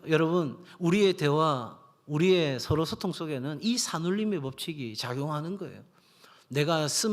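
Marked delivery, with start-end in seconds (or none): none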